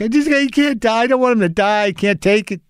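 high, panicky pleading; retold freak-out. Owner begging vet to save kitten, acted panic. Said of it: "freaking out about this kitten".

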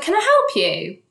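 loud, high challenge; confronting a stranger. Retold or imitated. Woman confronting man who stares at her, loud and sharp. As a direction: call him out sharply. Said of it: "And I just went".

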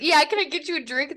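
high, loud hostess voice, over-sweet; mock polite host. Friend retells a TikTok, as a fussy host offering drinks.

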